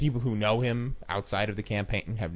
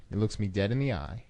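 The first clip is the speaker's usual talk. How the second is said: plain reading voice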